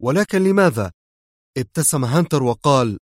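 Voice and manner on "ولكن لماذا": raised pitch, astonished question. A narrator's reading. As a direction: ask in open surprise. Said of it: "هاتف آلدو في دهشة".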